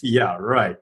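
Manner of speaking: low, dismissive "yeah, right"; sarcastic brain voice. Retold or imitated. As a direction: scoff at it like you don't buy it. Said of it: "your brain says".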